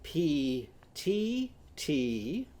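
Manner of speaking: "P T T" very slowly as pee-tee-tee; ee vowel. Shown as a demonstration. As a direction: stretch each beat with a long ee. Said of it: "Now it's".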